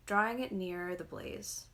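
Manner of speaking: plain reading voice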